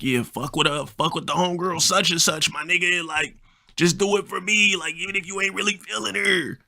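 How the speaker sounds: high, pushy homie impression; friend's voice. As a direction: talk him into it in a high buddy voice. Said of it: "Yeah, that's how he talked".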